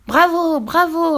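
put-on high, loud child's bravos; cheering. A narrator's reading. Pierre applauding the witches' insults, cheering. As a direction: cheer them on eagerly. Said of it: "applaudit Pierre".

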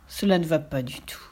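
soft, low, whispered verdict; whispered dismay. A narrator's reading. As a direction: breathe it out in disappointment.

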